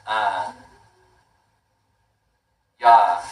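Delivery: ordinary talk, nothing marked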